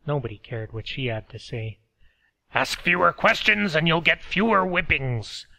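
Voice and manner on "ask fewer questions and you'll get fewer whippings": loud, higher guard voice; harsh guard. A narrator's reading. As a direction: bark it like a guard. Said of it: "the guards were fond of saying".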